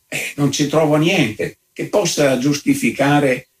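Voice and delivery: plain reading voice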